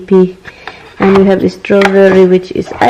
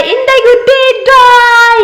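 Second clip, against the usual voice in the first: very high, drawn-out call; high-pitched, slow cheering shout-out; excited shout-out; 4 dB louder, slower than usual. Real, not acted. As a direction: sing out the name in a long high cheer. Calling out a shout-out.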